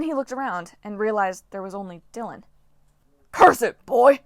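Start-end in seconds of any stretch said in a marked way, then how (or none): 3.3-4.2 loud, high angry snap; snapping. Acted. Coach annoyed at Jason, loud snapping rebuke. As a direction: bark it at the boy in frustration. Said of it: "he snapped at Jason".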